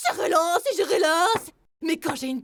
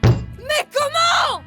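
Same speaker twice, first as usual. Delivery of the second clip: shocked cry; 9 dB louder, much slower than usual. Acted. Frustrated outburst at bad cards.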